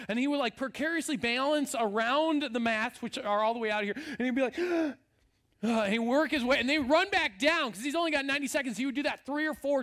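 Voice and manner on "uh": startled gasp. Retold or imitated. Vaulter stopping short, gasping.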